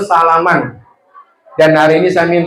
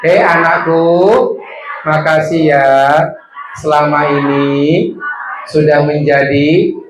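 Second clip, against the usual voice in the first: slow dictation; 2 semitones lower than usual. Shown as a demonstration. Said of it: "Bilang sama anaknya".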